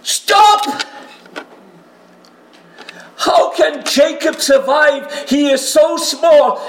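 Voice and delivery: very high, loud pleading; frantic shouted plea for mercy; desperate begging shout. Acted. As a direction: cry out to God, begging. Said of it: "He says".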